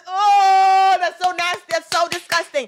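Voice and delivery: loud, high cry of disgust. Real, not acted. Vlogger recoiling at the scandal, loud disgust. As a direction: let out a revolted shout.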